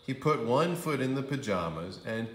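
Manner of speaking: usual speaking voice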